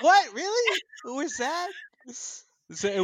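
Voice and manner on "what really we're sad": very high, loud mock incredulity; high-pitched imitation of baffled surprise; retold disbelief. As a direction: squeal it in comic disbelief. Retold disbelief at friend's gasp.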